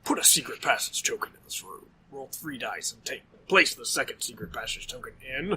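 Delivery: low, soft horror narrator; deep, measured Lovecraftian storyteller. Acted. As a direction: read it as hushed, ominous eldritch narration. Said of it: "I don't have to do the voice for every card".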